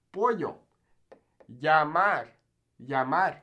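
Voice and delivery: "Pollo", "Llamar" with y for double L; Y sound. Shown as a demonstration. Teacher models double L words, Spanish Y sound. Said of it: "this makes a Y sound".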